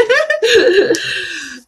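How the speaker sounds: high burst of laughter; laughing. Real, not acted. Host after offering to be wingman, laughing. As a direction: crack up at your own joke.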